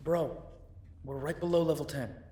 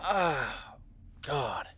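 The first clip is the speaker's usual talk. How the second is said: pained groan; 5 dB louder than usual. Acted. Relief after being yanked.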